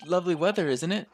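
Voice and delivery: British small talk, "isn't it" fully sounded; British accent. Shown as a demonstration. Player rehearses weather small talk, British accent. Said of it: "Gruff, gruff British voice".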